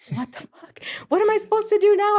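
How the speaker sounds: whispered curse, then high loud panic; frightened intern reliving a prison fight; retold panic. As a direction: hiss it, then burst out in high alarm.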